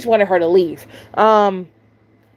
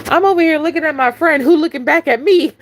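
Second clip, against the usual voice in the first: usual speaking voice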